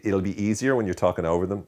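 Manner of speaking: usual speaking voice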